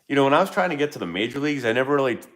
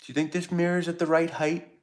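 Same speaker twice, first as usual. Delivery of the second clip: high, softer mock wife voice; imitating wife; 7 semitones higher, 5 dB quieter than usual. Husband mimics wife asking about mirror height, high voice. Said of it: "And she's like".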